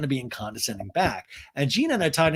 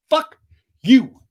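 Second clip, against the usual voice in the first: retold curse-out; 9 semitones higher, 10 dB louder than usual. Climax of retold fight.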